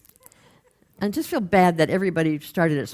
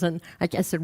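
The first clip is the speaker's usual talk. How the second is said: usual speaking voice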